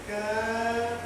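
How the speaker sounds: "can" bem alongado e agudo; sílaba de comando. Shown as a demonstration. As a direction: segure o "can" como ordem. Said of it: "é o can". ("can" sustentado em tom alto)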